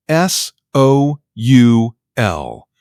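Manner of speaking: each letter drawn out, one by one; slow, deliberate spelling; spelling it out slowly. Acted.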